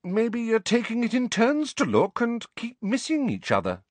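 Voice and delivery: high, teasing suggestion; suppressed amusement. A narrator's reading. Hermione gently mocking the quarrelling friends, amused. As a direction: tease them, holding back a smile. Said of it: "corners of her mouth twitching".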